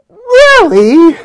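high, loud, laughing disbelief; a delighted squeal of surprise; thrilled laughing question; amazed really. Retold or imitated. Wife's amazed reply.

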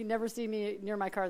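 ordinary talk

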